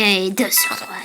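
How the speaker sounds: usual speaking voice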